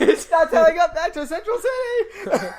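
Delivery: high, loud laughing delivery. Real, not acted. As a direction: shout it through laughter. Joke amid loud laughter.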